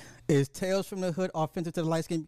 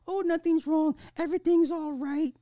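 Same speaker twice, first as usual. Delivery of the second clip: put-on girlfriend's voice; 11 semitones higher, 6 dB louder than usual. Girlfriend voiced hiding pregnancy.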